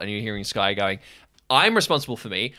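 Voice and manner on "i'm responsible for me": fast defiant retort. Acted. Sky arguing back.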